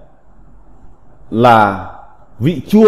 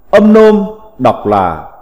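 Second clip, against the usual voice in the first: ordinary delivery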